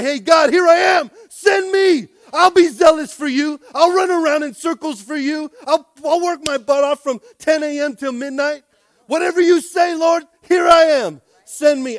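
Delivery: crying out to God at full volume; a high, loud offer of self; fervent shouted surrender; shouted prayer. Acted. Preacher voicing a prayer, shouting.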